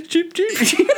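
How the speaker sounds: high cheeps through laughter; chicken cheep, laughing. Retold or imitated. Cohost laughing, imitating Johnny's chicken noise. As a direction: giggle out a high chick peep. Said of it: "his chicken noise is cheep".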